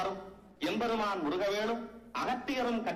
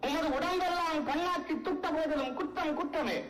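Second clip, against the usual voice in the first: ringing defiance climbing higher; defiant declamation; 4 semitones higher than usual. Acted. Critic defies the god over a fault, defiant. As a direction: stand firm and proclaim the verdict.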